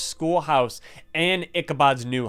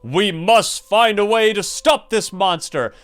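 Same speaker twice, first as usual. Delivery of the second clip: raised, higher mayor's cry, moderately louder; yelling mayor; 6 semitones higher, 5 dB louder than usual. A narrator's reading. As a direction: call the town to stop the monster with some force. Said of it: "the mayor yelled".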